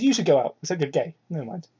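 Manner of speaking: ordinary talk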